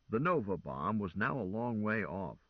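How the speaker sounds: ordinary delivery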